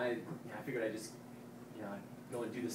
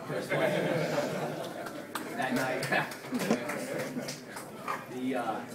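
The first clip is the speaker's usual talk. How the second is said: laughing; 4 semitones higher, 7 dB louder, slower than usual. Real, not acted. Funny football story, laughing.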